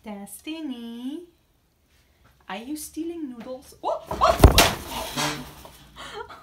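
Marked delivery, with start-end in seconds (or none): 3.8-6.4 sudden high startled yelp; startled cry. Real, not acted. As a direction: jump out of your skin, cry out. Startled by bird screaming.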